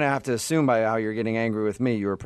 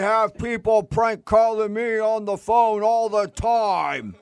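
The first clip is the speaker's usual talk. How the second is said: Harry voice; 10 semitones higher, 4 dB louder, slower than usual. Retold or imitated. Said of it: "was it".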